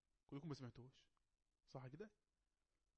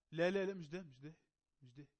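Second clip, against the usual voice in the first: emphatic correction; 8 dB louder than usual. Real, not acted. Teacher rejects the student's wrong video, emphatic correction. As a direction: cut in loudly to reject the answer.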